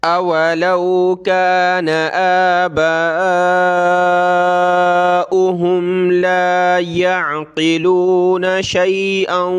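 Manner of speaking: slow, drawn-out tilawat; Quran recitation. Shown as a demonstration. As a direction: recite the verse slowly with melody. Recites the rest. (धीमी सुरीली तिलावत)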